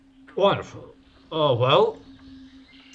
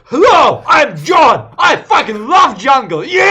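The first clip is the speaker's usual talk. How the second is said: much higher, much louder shout; shouting enthusiast; 15 dB louder than usual. Acted. Game master voicing John bellowing his love of jungle. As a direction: yell it like you love the jungle. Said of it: "and he goes".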